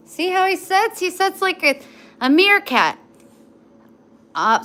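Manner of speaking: loud, high commands to the dog; loud high-pitched commands to the dog. Real, not acted.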